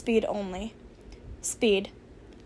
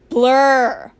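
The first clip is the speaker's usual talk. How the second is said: ordinary delivery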